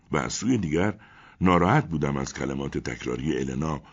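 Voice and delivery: usual speaking voice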